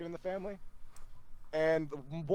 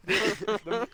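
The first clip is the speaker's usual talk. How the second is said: ordinary delivery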